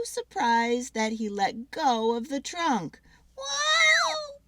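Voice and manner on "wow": high loud squealing wow; startled squirrel squeal. Acted. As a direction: squeal it out as you tumble. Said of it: "squealed Pip".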